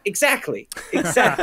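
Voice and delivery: eager agreement. Retold or imitated. Agreeing with the actor's idea, eager. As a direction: pile on the agreement.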